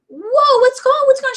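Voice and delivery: startled. Retold or imitated. Consultant startled by a crying customer, high surprised voice. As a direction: caught off guard, asking in alarm.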